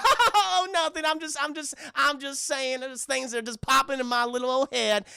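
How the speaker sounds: high-pitched fake innocent backpedal; Gideon. Gideon covering up to Mabel, high and falsely light. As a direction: laugh it off in a high put-on voice.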